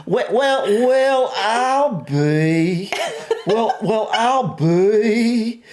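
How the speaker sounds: high, drawn-out mock Southern belle; hammed-up high Southern society accent; classy Southern accent. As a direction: play a well-off Southern lady, amused. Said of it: "give us a little bit of classiness".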